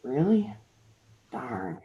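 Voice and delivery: soft, weak sick voice; nasally sick voice. Acted. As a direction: play sick and stuffed up. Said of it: "switches to a nasally sick voice".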